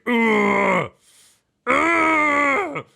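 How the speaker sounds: high, loud strained grunts; straining grunt. Acted. Giant strains to pull the rod from the snow, grunting. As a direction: heave with all your might.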